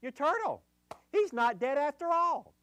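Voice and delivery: high, excited discovery; excited dad. Retold or imitated. A dad showing his son the turtle, high and excited. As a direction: call out high like a thrilled father. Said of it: "He said".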